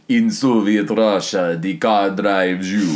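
slow, loud Soviet Russian; thick mock-Russian, drawn out like Dracula. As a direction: lay on a heavy Russian accent.

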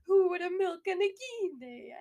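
put-on poor, loud, high Italian accent; hammy mock Italian accent. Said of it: "poor version of an Italian accent".

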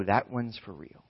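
quietly decide it is serious; hushed note to self; concerned parent. Acted. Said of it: "and you go".

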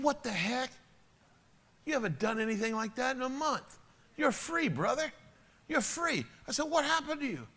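pitched high, pleading and incredulous; shocked plea to a lost brother; high pleading disbelief. Retold or imitated. Pastor urges his friend to church, high and incredulous.

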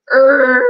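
loud imitated screech of a dragged table; loud table scrape. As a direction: make a drawn-out, loud scraping noise. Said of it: "really loud and, like, echoed".

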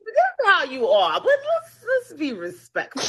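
high, drawn-out mock scolding; mock reproving man. Retelling his objection to cussing, hammed-up high reproach. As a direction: imitate his scolding, laughing through it. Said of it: "the man can't stand for me to cuss at him".

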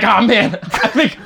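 high-pitched laughing punchline; laughing joke. Retold or imitated. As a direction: giggle it out as a joke to the room. Retelling joke about staying longer.

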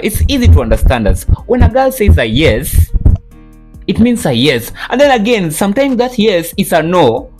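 high, slow girly mock voice; hammed-up girl explaining herself; mocking girl's voice. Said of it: "afu u dem anasema".

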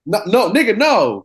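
high, loud no; loud refusal. Real, not acted. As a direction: shout the no down. Refusing a year.

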